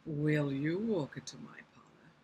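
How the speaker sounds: low, put-on spider voice; cunning spider voice. Shown as a demonstration. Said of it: "let's try some different voices".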